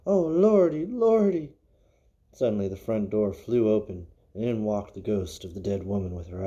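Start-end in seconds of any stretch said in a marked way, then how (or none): 0.0-1.5 high, loud frightened wail; scared old gravedigger crying out; terrified cry. Acted. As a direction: cry out shaking with fear. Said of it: "shivered and shook and cried".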